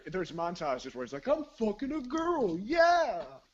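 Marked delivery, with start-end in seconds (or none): 1.3-3.4 high, loud crowing yell; exultant yelling. Retold or imitated. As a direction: yell it like a bragging movie character. Acting out montage character.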